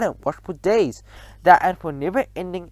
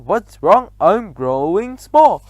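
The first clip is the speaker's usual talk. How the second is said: louder alarmed cry; a hint of panic; 4 semitones higher, 6 dB louder, slower than usual. A narrator's reading. Tall boy shrinking, crying out. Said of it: "cries out".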